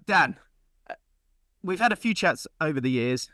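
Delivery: ordinary delivery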